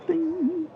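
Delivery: high sung-out ding; high-pitched vocal button chime; imitated chime. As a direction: mimic a little bell with a high, held note.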